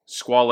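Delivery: Squale said with two syllables, ending in "ay"; common pronunciation. Shown as a demonstration. Reviewer telling viewers how many people say the brand, Squale in two syllables. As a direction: say Squale as "skwah-lay". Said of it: "is how a lot of people say it".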